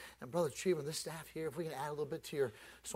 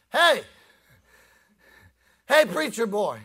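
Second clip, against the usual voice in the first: loud, higher hail from afar; calling across the street; 9 semitones higher, 16 dB louder, slower than usual. Pretend. Saved woman hailing the preacher across heaven's street, loud. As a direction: shout across the street to an old friend.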